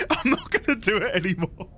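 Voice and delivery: high, helpless laughter through the words; talking through a laughing fit. Real, not acted. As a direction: squeeze it out while cracking up. Laughing at criticism of his accent.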